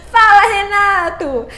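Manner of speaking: loud, drawn-out hail; slow, loud shout of hello; calling out. Real, not acted. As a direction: holler the greeting, stretching it out. Calling out a greeting to viewer.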